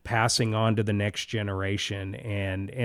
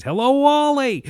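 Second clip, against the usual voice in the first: much higher, much louder, big exaggerated greeting; emphatic read-aloud; 9 dB louder than usual. Shown as a demonstration. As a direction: read it like an animated kids' book. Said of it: "I read with emphasis".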